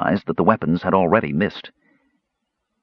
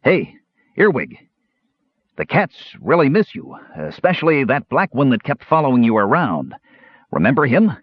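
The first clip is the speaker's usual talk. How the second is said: babbling; 5 semitones higher than usual. Acted. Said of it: "Caramon began to babble".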